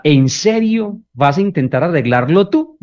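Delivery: sarcastic doubt at a partner's attempt to fix things; sarcastic jab. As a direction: needle them with sarcasm. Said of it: "le dice a la otra persona".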